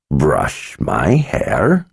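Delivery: slow, breathy whispered question; puzzled whisper. Acted. Old wizard to the little girl, puzzled whisper. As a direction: whisper it, half amused and baffled. Zed puzzled, quiet aside.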